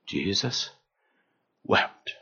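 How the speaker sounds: soft, whispered Jesus wept; hushed. Acted. Shortest verse, said hushed.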